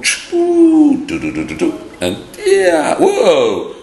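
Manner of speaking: high, loud whoosh and tumbling noises; playful sound effects. Acted. Storyteller acts out caps thrown down, whooping sound effects. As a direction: voice a swoop, bounces and a whoop.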